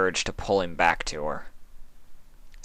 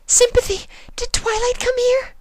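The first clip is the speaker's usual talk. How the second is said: anxious Fluttershy; 5 dB louder than usual. Acted. Fluttershy asking Sympathy, very high anxious voice. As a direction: ask in a hurried worry.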